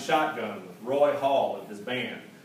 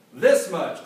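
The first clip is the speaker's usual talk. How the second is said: louder, higher punch of pride; proud reply; 5 semitones higher, 10 dB louder than usual. A narrator's reading. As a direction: slam it down with a boast. Clayton dumps the sack.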